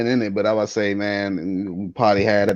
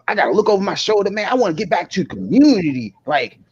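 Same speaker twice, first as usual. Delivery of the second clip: higher-pitched mock imitation of an earnest plea; weird talker; 7 semitones higher than usual. Podcaster mimics man wanting back into the community, weird talk. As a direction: mimic his odd talk, pitched up and pleading. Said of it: "he talk real weird as hell".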